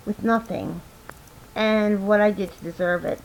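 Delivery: usual speaking voice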